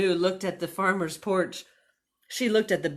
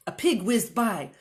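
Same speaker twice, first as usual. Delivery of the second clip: ordinary delivery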